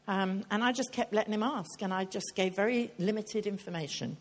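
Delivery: ordinary talk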